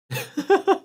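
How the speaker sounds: squeaky laugh breaking out; high burst of laughter. Real, not acted. Streamer laughing at own mishap, high giggle.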